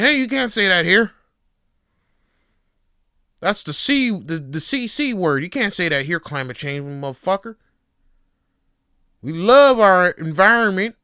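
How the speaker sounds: loud, high-pitched Southern hillbilly yell; Southern accent. Acted. Objector scolding podcaster for saying climate change, shouted Southern accent. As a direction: holler in a hammed-up Southern accent. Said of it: "I'm going with a southern accent".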